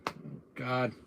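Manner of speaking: normal talking voice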